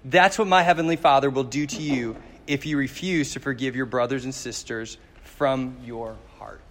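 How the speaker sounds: ordinary talk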